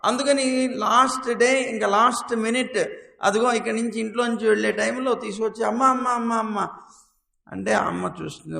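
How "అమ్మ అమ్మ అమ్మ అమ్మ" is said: lightly voiced child, calling repeatedly; a boy nagging for attention; child pleading mom. Child pesters mother at last minute. (అమ్మను బతిమాలే పిల్లవాడి గొంతు)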